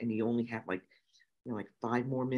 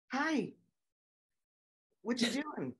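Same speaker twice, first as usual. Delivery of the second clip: hi and what you doing in a much higher voice, sing-song; mock innocent hi; 10 semitones higher, 5 dB quieter than usual. Greeting the student in his chair, falsetto innocent hi. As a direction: play dumb with a high sweet greeting. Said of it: "And I'm like".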